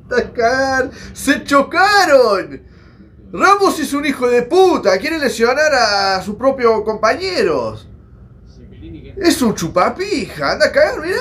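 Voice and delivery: fuming at a teammate's foul; angry outburst. Real, not acted. Reacts to players colliding. (arrebato de bronca)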